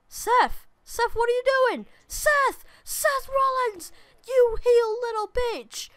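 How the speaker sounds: real outraged shout at a betraying partner; outraged yell. Real, not acted. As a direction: scream at the traitor on screen. Partner betrays him in game.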